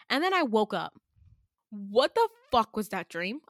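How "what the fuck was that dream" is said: ordinary talk, nothing marked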